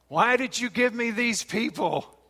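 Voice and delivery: high, loud exasperated cry; Moses complaining. Retold or imitated. Moses crying out to God about the people, loud and exasperated. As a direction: plead like a fed-up leader. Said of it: "Moses comes to God".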